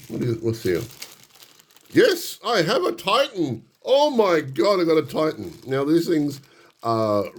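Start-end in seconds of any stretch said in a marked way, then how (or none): 1.9-5.5 much higher, thrilled outburst; giddy joy at finding the Titan; real excitement. Real, not acted. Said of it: "You can hear the excitement in my voice".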